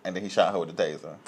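usual speaking voice

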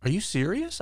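high, disbelieving question; incredulous. Retold or imitated. Barista handed a bag of shoes, incredulous. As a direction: ask it amazed, pitch up.

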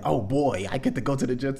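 high, loud hammed-up glee; mock excitement. Mocking the gym fans with hammed-up excitement. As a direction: gush like a gym lover who can't wait. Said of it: "I don't wake up just excited".

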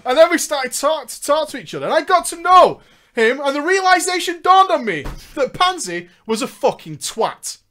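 emphatic, projected storytelling; narrating a gaming memoir; story narration. Acted. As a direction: read it out with mounting emphasis. Reads story aloud amid own chat.

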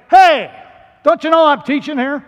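loud, high shout at interrupters; indignant scolding. Acted. Teacher scolding people who interrupt the sermon, indignant shout. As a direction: call out sharply, as an interrupted teacher.

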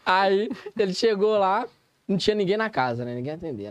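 usual speaking voice

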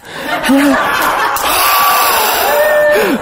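airy, high delighted laugh; breathy laugh. Real, not acted. After whispering to the couple, a breathy laugh. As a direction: let a breathy laugh escape.

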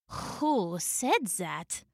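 low, soft, slow cat voice; meowing cat. A narrator's reading. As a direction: meow it slowly and quietly. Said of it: "meowed Luda".

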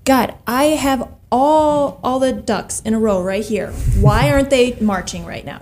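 mildly put-upon complaint to God; a touch of retold grievance; complaining. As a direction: imitate someone questioning God's timing. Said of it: "I'm also thinking of complaining".

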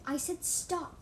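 high, firm order; loud hiss. A narrator's reading. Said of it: "I hissed loudly".

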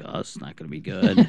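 hushed aside to a friend; muttered aside. Retold or imitated. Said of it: "I'm like".

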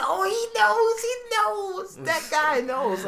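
very high, loud squeaky voice; hammed-up squeaky fan voice; excited fan. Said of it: "And I point, it's just".

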